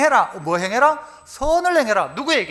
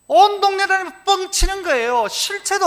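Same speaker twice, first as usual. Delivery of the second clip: mocking; 8 semitones higher, 4 dB louder than usual. Mocking braggarts around town.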